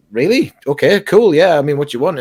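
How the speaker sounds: taken aback but going along with it; surprised agreement. Retold or imitated. Retelling his own reply to an offer, surprised.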